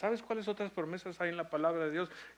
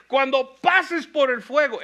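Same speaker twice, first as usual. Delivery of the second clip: loud, high, slow emphatic reading of "Cuando pases por las aguas"; emphatic repeat; 10 semitones higher, 18 dB louder, slower than usual. Shown as a demonstration. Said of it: "Una vez más".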